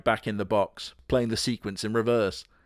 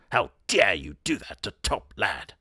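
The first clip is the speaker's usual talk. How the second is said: 1 indignant doll; 5 semitones lower than usual. Pretend.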